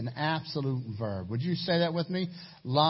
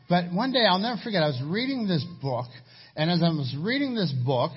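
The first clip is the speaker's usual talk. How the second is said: ordinary delivery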